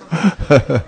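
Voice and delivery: amused chuckle. Real, not acted. Preacher amused by bar's name, chuckling. Bar named Kali Yuga.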